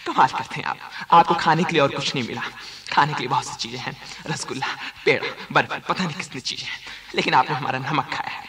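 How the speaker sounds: a deep mock Gabbar menace; Gabbar Singh. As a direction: growl it like Gabbar interrogating. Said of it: "Gabbar Singh का role".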